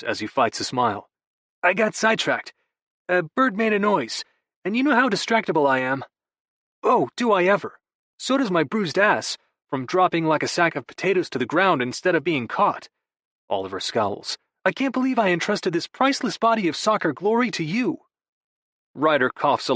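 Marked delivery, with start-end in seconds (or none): 1.6-6.1 lightly raised, breezy younger brother; a hint of a sheepish brother; Vigo's voice. Vigo voiced by narrator. 6.8-12.9 higher, indignant jock brother; milk the grievance for laughs; hammed-up wounded athlete; Oliver's voice. 14.6-18.1 higher, indignant jock brother; milk the grievance for laughs; hammed-up wounded athlete; Oliver's voice. Oliver scowls, complains.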